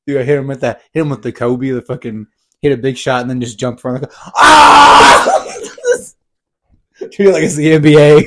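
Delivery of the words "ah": high wild yell; triumphant scream. Acted. Imagined jump celebration.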